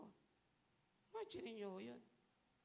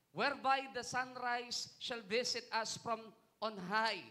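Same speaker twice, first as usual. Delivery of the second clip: loud, raised, slow scripture proclamation; preaching at full force; 6 semitones higher, 8 dB louder than usual. Acted. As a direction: proclaim the verse to the whole congregation. (malakas at madiing pangangaral)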